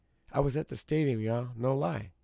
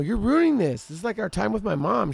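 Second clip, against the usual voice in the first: high, loud upset yell; angry complaint; 10 semitones higher, 6 dB louder than usual. Retold or imitated. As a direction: protest the ruined outing. Pleading angrily with woman.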